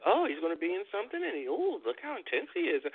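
high-pitched mock fascination; mock excitement. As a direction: imitate an easily impressed TV viewer. Mocking imagined viewers' excitement over Cena.

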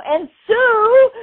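high, loud mock shout for recognition; shouted demand. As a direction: yell it up high, half joking. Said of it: "I want recognition".

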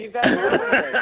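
words broken by a high laugh. Real, not acted. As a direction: laugh through the joke. Laughing at the heroin veins joke.

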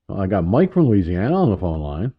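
ordinary delivery

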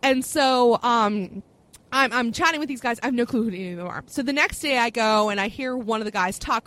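ordinary talk, nothing marked

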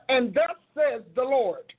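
slow, full, grounded "And thus says the Lord"; mature preacher voice. Shown as a demonstration. Showing how her preaching voice matured, slow and weighty. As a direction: proclaim it with grown authority. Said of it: "Baby, I moved up".

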